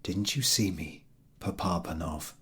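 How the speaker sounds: soft, fading whisper. A narrator's reading. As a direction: hush it like a ghostly visitor. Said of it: "each whispered".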